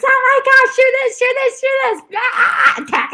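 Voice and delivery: high, panicked nagging voice; hammed-up high panic; frantic alert. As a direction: squeal urgent orders in mock panic. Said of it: "so attuned to all of these alerts".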